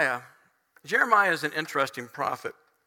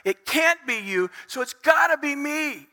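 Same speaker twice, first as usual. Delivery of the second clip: raised, emphatic reasoning with God; frustrated appeal, peaking on the last words; troubled self-blame; 4 semitones higher, 3 dB louder than usual. Acted. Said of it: "looks at himself and he says".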